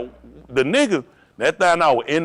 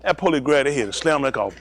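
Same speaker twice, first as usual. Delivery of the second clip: ordinary delivery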